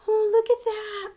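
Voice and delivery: usual speaking voice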